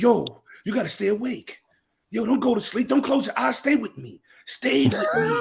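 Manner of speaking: rising pleading voice; dying-friend movie plea. Acted. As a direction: plead with a shot buddy. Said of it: "It's like one of those movies when somebody gets shot".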